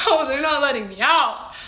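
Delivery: hammed-up alarm with a laugh; comic panicked realization; mock panic. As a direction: play up the dread of being trapped. Retold panic at losing passport.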